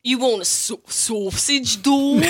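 bad mock Australian accent, drawn-out slowly at the end. To his co-hosts, trying sausage dog in a hammed-up Australian accent. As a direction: put on a cheesy Australian accent for good day. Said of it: "how to say sausage with an Australian accent".

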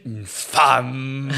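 drawn-out, admiring "femme"; reverent. Acted. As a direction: say it as if enshrining her. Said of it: "ça la sacralise magnifiquement". (solennel, « femme » étiré)

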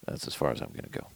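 hushed fast whisper; whispered aside. Real, not acted. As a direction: whisper it off to the side. Aside after singing.

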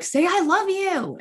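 high, slow pleading demand; imitating her teenage self begging mom. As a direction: daughter at thirteen urging mom on the phone, high and pleading. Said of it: "and I was like".

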